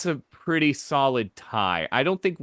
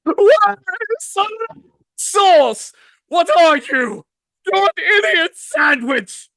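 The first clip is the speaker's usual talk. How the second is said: much higher, loud furious chef yelling; Gyutaro as Gordon Ramsay; 8 dB louder, slower than usual. Pretend. Gyutaro yelling at a cook like Gordon Ramsay, shrieking. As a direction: scream insults like an enraged chef. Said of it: "Gordon Ramsay".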